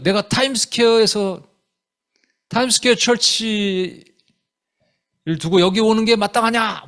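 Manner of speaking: high-pitched mock indignant protest; mock complaint. Church member complaining to the pastor, high mock indignation. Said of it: "그런 거를 저한테 컴플레인하면".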